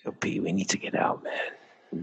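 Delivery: hushed secret whisper. Pretend. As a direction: whisper it like someone might hear. Hiding, repeats plea in whisper.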